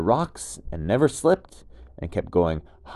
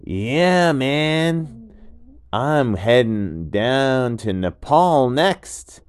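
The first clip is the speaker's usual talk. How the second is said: much higher, louder, slow easygoing dude voice; laid-back gecko; 3 semitones higher, 9 dB louder, much slower than usual. Acted. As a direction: call up cheerfully from below. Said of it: "And the gecko said".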